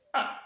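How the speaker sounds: "Ah" cut very short; brief, abrupt "Ah"; fourth tone. Shown as a demonstration. Teacher repeats the brief fourth tone, "Ah" cut short. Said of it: "il est grave et bref".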